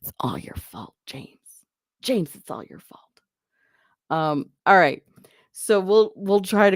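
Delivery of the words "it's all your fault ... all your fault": mock blaming whisper. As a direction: breathe it like a conspiratorial accusation. Teasing James for the subscriber.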